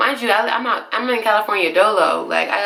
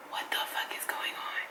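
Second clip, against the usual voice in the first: acted, hushed shock under the breath; stunned whisper; 17 dB quieter than usual. Tearful passenger to herself in the back seat, stunned whisper. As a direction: whisper it in disbelief, barely any voice. Acting stunned thought while crying.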